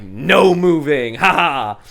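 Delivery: loud, drawn-out mock triumph; playful gloating. Pinning the friend's foot under his own, playful gloating. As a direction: stretch it out with a teasing ha ha.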